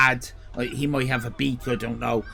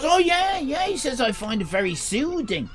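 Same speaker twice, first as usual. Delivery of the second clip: higher-pitched, lightly voiced fan; imitated fan; 11 semitones higher than usual. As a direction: lightly mimic an eager admirer, pitched up. Acting out the American's reassuring reply.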